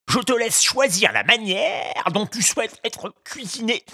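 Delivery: high, falsely gracious fisherman; courteous ogre. A narrator's reading. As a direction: offer the menu with a grin. Fisherman offering choice. (voix d'ogre faussement aimable)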